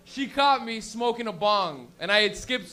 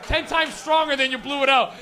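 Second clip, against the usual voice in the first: mock exclaim; 4 semitones higher, 7 dB louder than usual. Comic outburst about smoke.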